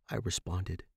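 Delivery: ordinary delivery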